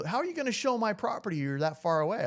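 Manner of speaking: imitating doubtful rancher. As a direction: play the rancher challenging the agent's distance.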